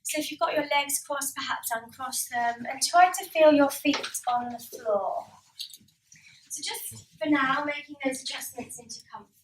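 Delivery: calm instructor leading a relaxation exercise; guided relaxation. Acted.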